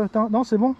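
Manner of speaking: ordinary talk, nothing marked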